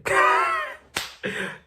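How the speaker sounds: high-pitched burst of laughter. Real, not acted. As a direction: cackle at your own joke. Laughing at own marriage joke.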